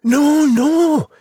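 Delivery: put-on high, drawn-out boy's protest; insistent high child voice; protesting cry. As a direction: cry it out as young Jack, pitched high. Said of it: "cried Jack".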